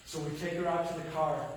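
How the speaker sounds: ordinary talk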